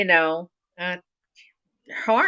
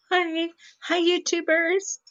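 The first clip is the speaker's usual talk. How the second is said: bright sing-song hello up high; high greeting; 11 semitones higher than usual. Real, not acted. Greeting viewers at stream start.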